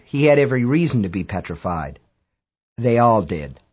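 usual speaking voice

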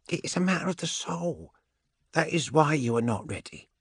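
high gene-witch voice, measured verdict; solemn, high character voice; Andromeda, pronouncing. Acted. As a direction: state it as a high-voiced seer.

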